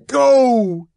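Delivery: enraged man standing over the woman, shouting; furious shout. Acted. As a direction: drive her out with one shout. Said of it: "he shouted".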